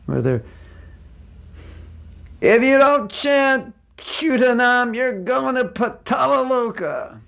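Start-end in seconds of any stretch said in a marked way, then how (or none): 2.4-7.2 high, slow, stretched-out street-preacher cry; mock doomsday proclamation; Gita-thumping preacher. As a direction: threaten hellfire like a hammy street evangelist. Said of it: "Gita thumpers on the street".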